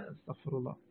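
very soft, low murmur; barely audible plea for forgiveness; exasperated pious aside. Real, not acted. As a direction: say it under your breath as you give up. Gives up explaining, asks forgiveness.

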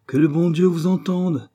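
high, emphatic royal exclamation; exclaimed wish. A narrator's reading. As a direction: cry it out like a fervent wish. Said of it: "s'écria Louis seize".

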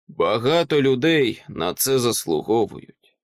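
ordinary delivery, nothing marked

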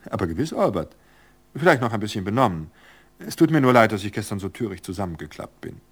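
a sprightly wife brushing off worry with a laugh; cheerful Virgilia. Acted. Narrator voices Virgilia on phone.

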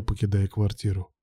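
usual speaking voice

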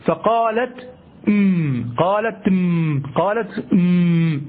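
قالت أم drawn out slowly, the hamza dropped in linking; slow drilled recitation. Shown as a demonstration. Teacher to students, drilling the linked hamza, slowly. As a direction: spell out قالت أم slowly for the class. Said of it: "همزة الوصل تسقط في الوصل".

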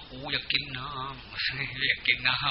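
slow, soft, parched croak; hoarse thirsty dead woman. Retold or imitated. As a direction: rasp it out like a corpse begging for water. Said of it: "คอแหบคอแห้ง". (เสียงแหบแห้งช้าๆ อยากกินน้ำ)

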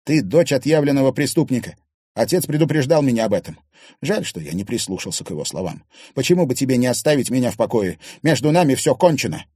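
loud, fast cold accusation; metallic hardness. A narrator's reading. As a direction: cut her off for good. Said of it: "металлические нотки". (холодно, громко, быстро)

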